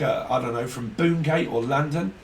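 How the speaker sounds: ordinary talk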